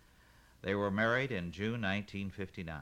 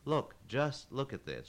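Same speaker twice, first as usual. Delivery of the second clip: higher, sharper demand; 6 semitones higher than usual. Acted. Kaylin demanding the parts man look at her nails. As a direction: push it at him, insistent. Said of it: "she demanded".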